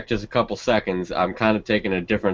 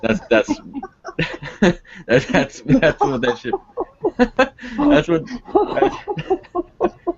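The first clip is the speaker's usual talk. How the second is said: laughing; slower than usual. Real, not acted. Breaking up laughing.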